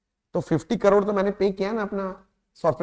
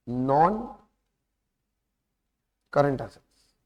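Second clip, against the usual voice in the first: lower, word by word with long pauses; dictation pace; 5 semitones lower, much slower than usual. Shown as a demonstration. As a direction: spell it out for students to write. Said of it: "dictating heading for notes". (Notes लिखवाते हुए रुक-रुक कर)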